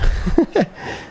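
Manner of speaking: short, high breathy chuckle; real amused chuckle; short laugh. Real, not acted. Streamer amused by such a realistic hockey game, short high laugh.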